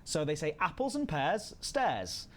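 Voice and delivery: ordinary talk, nothing marked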